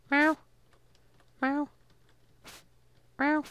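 usual speaking voice

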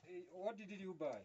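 ordinary delivery

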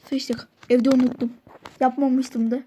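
ordinary talk

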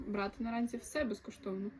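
ordinary talk

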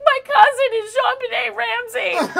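high, slow, crying words; tearful reveal. Retold or imitated. High slow crying reveal. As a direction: drunk girl tells the comedian her cousin. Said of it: "she was like".

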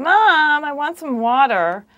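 put-on loud, high American-accented toddler whine; imitated whiny American child. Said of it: "whining in an American accent".

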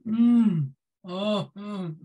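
loud drawn-out moans of pleasure; imitating blissful cheese moans. Imitating the friend's loud moans of delight over cheese. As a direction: moan like the cheese is heavenly.

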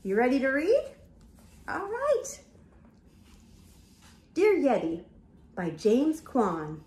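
usual speaking voice

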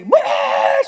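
high loud mock wail; child wail. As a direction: wail like a toddler who lost her toy. Girl goes crazy over toy.

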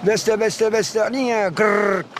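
slow lilting phrase ending in a rolled trill; bird song demo. Shown as a demonstration. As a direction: imitate a songbird with a gırr finish. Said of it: "Abi şimdi şakşağını ötüyor". (titretmeli kuş taklidi)

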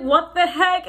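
retold shock, high and loud; high, loud disbelieving exclamation; startled outburst. Retold shock at being choked.

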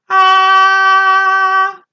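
put-on high, loud sustained ah for the kids to copy. Shown as a demonstration. Teacher showing children how to use their voice, loud. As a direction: belt out a long ah. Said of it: "Can you go".

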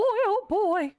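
put-on high squeaky nervous oh boy. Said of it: "Oh, boy. Oh, boy".

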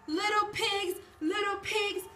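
high sing-song wolf at the door. Acted. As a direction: call out like the big bad wolf. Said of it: "he said".

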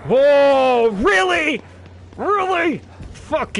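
loud and high, genuinely startled; shocked whoa, really; startled shout. Real, not acted. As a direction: yelp it in disbelief. Startled by enemy.

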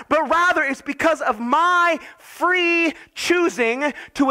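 high, impassioned pulpit voice, slowing on the key words; fervent preaching. Acted. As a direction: proclaim God's love to the church. Preaching God's reassurance.

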